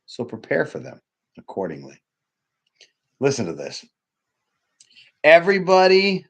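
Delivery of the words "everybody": big, loud and high; booming opener. Real, not acted. Speaker rousing the audience with a loud high opener. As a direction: throw the first word out to the room.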